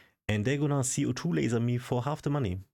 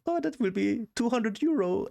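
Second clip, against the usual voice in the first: much higher mocking assistant voice; 13 semitones higher than usual. Assistant announcing the bill, hammed-up high mock voice. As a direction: chirp the price like a cheerful assistant. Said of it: "and she's like".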